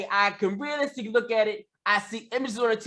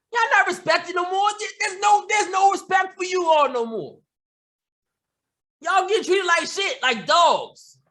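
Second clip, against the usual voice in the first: much higher, shouting at the listeners; angry shout; 8 semitones higher than usual. Real, not acted. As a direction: let the anger boil over.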